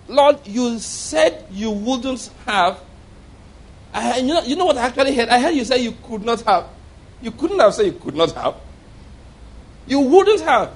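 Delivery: high-pitched, incredulous mock protest; incredulous arguing. Retold man correcting the Lord's words, high incredulous protest. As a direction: argue it back, pitch climbing in disbelief.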